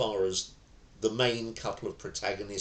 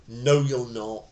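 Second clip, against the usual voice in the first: defiant retort; 7 dB louder, slower than usual. Shown as a demonstration. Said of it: "The minute you go".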